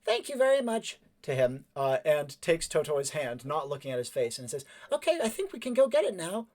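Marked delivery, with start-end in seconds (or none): 0.0-1.0 put-on high-pitched polite kid voice; high, huffy politeness; prim young character. Voices Cleveland, a game character. 4.9-6.5 put-on high-pitched polite kid voice; high, huffy politeness; prim young character. Voices Cleveland, a game character.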